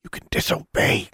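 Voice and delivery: urgent, coaxing whisper; hushed, insistent urging. A narrator's reading. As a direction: whisper it as a temptation. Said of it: "she whispered".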